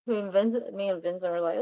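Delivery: ordinary talk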